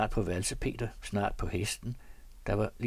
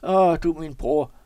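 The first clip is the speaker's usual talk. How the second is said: raised, loud lament; mock wailing; 7 semitones higher, 10 dB louder than usual. A narrator's reading. Storyteller imitates bereft farmer, mock wailing. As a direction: wail it out, louder and higher. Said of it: "gav sig til at hyle".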